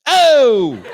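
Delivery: loud high jeering holler; hammed-up shout breaking into laughter; yelled "Oh!". Heckler capping a taunt, yelled out. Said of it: "You yell that shit".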